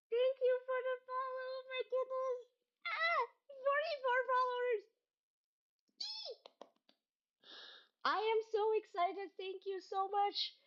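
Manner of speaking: high thrilled thanks; excited thanks. Real, not acted. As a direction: gush thanks at the followers. Thrilled by followers.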